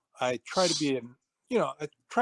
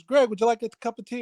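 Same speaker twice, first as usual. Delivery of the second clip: higher-pitched retold repeat offer; insistent offer; 7 semitones higher, 4 dB louder than usual. Instructor offering tea a third time, mildly insistent. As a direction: press the offer once more, pitched up.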